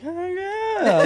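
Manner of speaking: high, laughing "oh yeah" of pride; proud whoop. Retold or imitated. Riders cheering themselves after crossing the river, high and laughing. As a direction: crow it out like you just nailed it. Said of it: "We were like".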